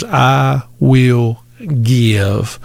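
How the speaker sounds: very slow, word by word; slow, emphatic quotation. Retold or imitated. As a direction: let each quoted word stand alone. Preacher quoting key words of verse.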